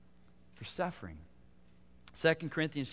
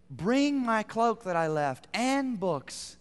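slow, higher pleading request; Paul's plea; 7 semitones higher, 4 dB louder, much slower than usual. Retold or imitated. Apostle repeating his request to Timothy, slow plea. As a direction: draw it out gently as the apostle. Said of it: "It's almost like".

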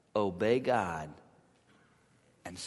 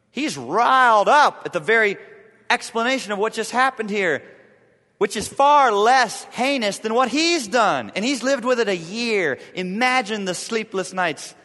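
loud, higher preaching push; preaching at full force; 7 semitones higher, 9 dB louder than usual. Acted. Preacher stirring up his congregation, loud and high. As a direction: drive the point home from the pulpit.

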